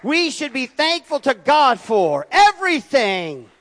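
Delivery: loud, high, emphatic preaching cadence; full-force preaching. Real, not acted. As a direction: build to a ringing proclamation from the pulpit.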